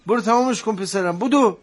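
high, loud hurrying along; insistent urging. Acted. Father sending son off to finish homework, insistently. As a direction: raise the voice to send the child off.

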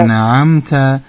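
انعمت with a clear ain in the middle, said slowly; correct ain. Shown as a demonstration. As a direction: stress the ain sound in انعمت. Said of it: "درست گفتید". (انعمت با تلفظ درست حرف عین)

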